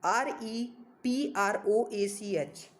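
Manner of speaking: each letter spelled out very slowly; slow spelling. Shown as a demonstration. Teacher spelling the word for students, slowly. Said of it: "hope spelling समझ आ रहा होगा".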